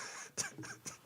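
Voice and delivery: silent wheezing laugh; high breathy laughter; breathless laugh. Real, not acted. Laughing at his own burp.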